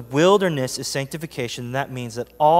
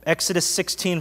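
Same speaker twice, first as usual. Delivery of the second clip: normal talking voice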